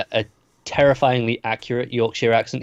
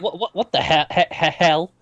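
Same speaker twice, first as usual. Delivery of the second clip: higher-pitched mock stuttering; flustered stammer; 6 semitones higher than usual. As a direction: trip over the word in mock fluster. Imitating Tilly stammering, flustered.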